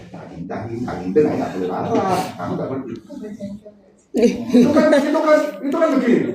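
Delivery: normal talking voice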